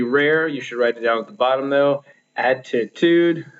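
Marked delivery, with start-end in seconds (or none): 2.4-3.5 "Attitude" slowly, AT and TUDE stressed; first and last stressed. Shown as a demonstration. Teacher giving an example foot, "Attitude" stressed at both ends. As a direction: draw out "Attitude", keeping the middle syllable light. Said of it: "The first and the last syllable of that are stressed".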